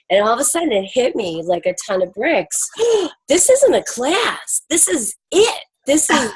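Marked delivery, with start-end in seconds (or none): 2.7-3.2 sharp gasp. Retold or imitated. Retells sudden realization. 3.3-6.3 excited realization. Retold or imitated. Retells moment of sudden realization.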